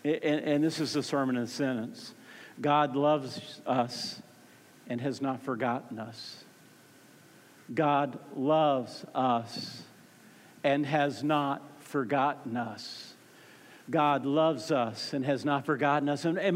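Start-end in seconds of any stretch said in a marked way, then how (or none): 2.6-13.1 low, very slow, word by word; slow, weighty statement. Real, not acted. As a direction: space out each word for weight. Sermon sentence repeated slowly for weight.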